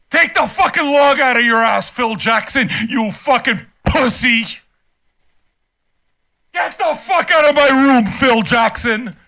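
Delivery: furious, emphatic dismissal; angry outburst. Real, not acted. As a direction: yell at the commenter to get out. Ordering the commenter out.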